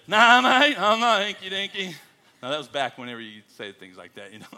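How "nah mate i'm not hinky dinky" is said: put-on loud, high Australian-accented retort; mock Australian reply. Australian guys answering the missionary, loud hammed-up Australian accent. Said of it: "All the guys go".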